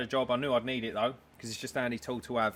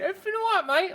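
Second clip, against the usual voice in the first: man's reply; 5 dB louder than usual. Retold or imitated. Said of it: "He went".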